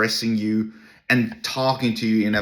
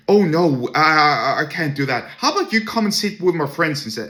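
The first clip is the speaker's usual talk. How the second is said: condescending guy; 6 semitones higher, 3 dB louder than usual. Retold or imitated. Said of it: "this condescending fella".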